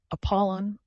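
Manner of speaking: high, emphatic "Apollon"; quiet, emphatic. A narrator's reading. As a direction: press on the name "Apollon". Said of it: "I said quietly and emphatically".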